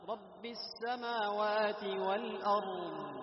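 slow recited والأرض with the راء drawn out too long; wrong reading. Shown as a demonstration. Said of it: "لاحظ هكذا يقرأون".

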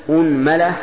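"أنملة" said as unmala; opening u, middle a. Shown as a demonstration. Teacher lists variants for students, "أنملة" as unmala. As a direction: say "أنملة" with damma on hamza, fatha on mim. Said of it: "ضم الهمزة".

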